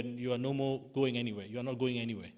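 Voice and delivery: usual speaking voice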